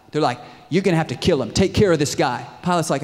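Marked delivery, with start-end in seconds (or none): 0.7-2.5 loud, higher crowd pressure; raised-voice insistent order; acted demand. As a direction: bear down on the governor, loudly.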